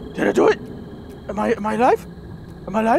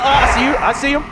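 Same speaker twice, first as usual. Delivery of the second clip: usual speaking voice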